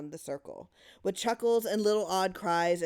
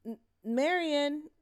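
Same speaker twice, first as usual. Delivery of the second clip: anxious call; 9 semitones higher, 3 dB louder, slower than usual. A narrator's reading. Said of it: "asked Louise".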